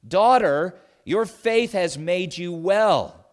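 Daughter rings out loud and slow; proclaiming. Acted. Jesus to the healed woman, ringing loud affirmation. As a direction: proclaim it like a blessing over the crowd. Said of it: "Jesus affirms the importance of her faith".

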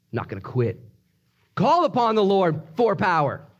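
usual speaking voice

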